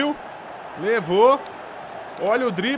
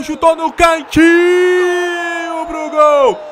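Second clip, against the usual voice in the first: excited shout; 6 semitones higher, 7 dB louder than usual. Real, not acted. Shot on goal in match.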